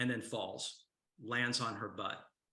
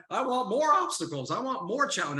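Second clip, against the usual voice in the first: much higher and louder, hammed-up eagerness; playfully mocking people who want more obstacles; mock eager plea; 10 semitones higher, 8 dB louder than usual. Speaker to the crowd, mocking eager challenge-seekers, hammed-up loud eagerness.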